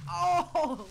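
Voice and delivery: sudden loud gasp of surprise; surprised shout. Real, not acted. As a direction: yelp it out, caught off guard. Sudden game event.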